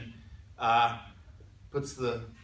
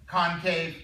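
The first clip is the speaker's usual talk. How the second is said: normal talking voice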